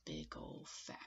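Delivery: hushed storybook whisper, slow. Acted. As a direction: whisper it to the children to build suspense. Hushed reading of a storm scene.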